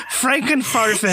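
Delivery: hammed-up German nonsense word; mock German accent. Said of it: "You sounded German".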